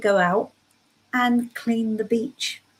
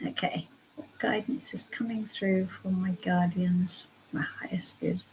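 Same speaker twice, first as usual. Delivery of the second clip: softly whispered, no voice; hushed whisper to the guardians; whispered question; 4 semitones lower, 10 dB quieter than usual. Real, not acted. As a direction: whisper the question over the cards. Repeats her question over cards.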